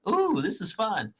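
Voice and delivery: high, gleeful little squeal of delight; excited Puck. Retold or imitated. Puck enjoying the chaos among the lovers, gleeful. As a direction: relish the mischief. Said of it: "Puck is excited".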